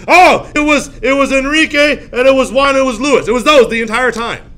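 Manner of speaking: high, loud frantic naming of names; hammed-up panicked confession; acted desperate confession. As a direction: blurt accusations to save your own neck. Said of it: "if you don't tell us who else you know".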